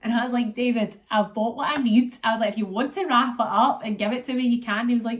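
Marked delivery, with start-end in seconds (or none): 0.5-2.2 raised, louder insistent voice; emphatic retelling. Retold or imitated. To boyfriend David about gifts, loud insistent. Telling boyfriend what she needs. 2.5-4.8 raised, louder insistent voice; emphatic retelling. Retold or imitated. To boyfriend David about gifts, loud insistent. Telling boyfriend what she needs.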